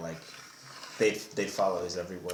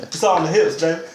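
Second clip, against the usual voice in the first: cocky swagger; 8 semitones higher, 11 dB louder than usual. Pretend. Joking swagger boast.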